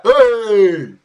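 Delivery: loud, high drawn-out mock holler; English yob. Streamer demonstrating an English lad's hail, mock yobbish shout.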